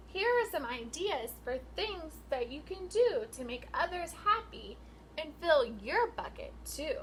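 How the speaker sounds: sing-song picture book read, rising and falling; reading to children. Acted. Teacher reading a rhyming book to her class, sing-song. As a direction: read the rhyme out to the class with lilt.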